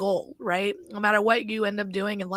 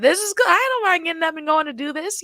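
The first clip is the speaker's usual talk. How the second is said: happy retiree; 8 semitones higher, 7 dB louder than usual. Retold or imitated. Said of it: "retirement time is like".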